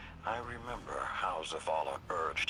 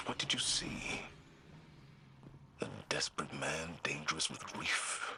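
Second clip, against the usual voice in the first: hushed. Acted. Tense confession about a buried friend.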